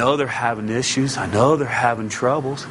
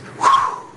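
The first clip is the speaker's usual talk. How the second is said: breath whoosh. Pretend. Imitating God's breath.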